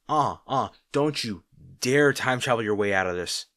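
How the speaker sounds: loud mock-stern tut-tut; loud teasing threat; playful warning. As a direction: wag a finger with your voice. Playful warning not to retract.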